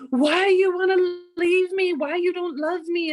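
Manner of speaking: high, loud mock wail; hammed-up wounded accusation; hysterical pleading. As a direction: imitate a distraught, clingy companion. Imitates companion's hysterical accusation.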